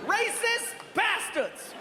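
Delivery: high, slow outraged yell; high slow furious cry; angry shout. Retold or imitated. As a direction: yell it through the door. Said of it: "we heard".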